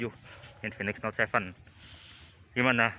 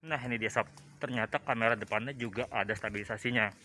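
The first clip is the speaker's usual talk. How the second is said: muffled behind a thick mask; through a face mask; 7 dB quieter than usual. Real, not acted. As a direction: talk through a two-layer mask. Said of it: "ini saya pakai masker, jadi suaranya agak ketutup ya".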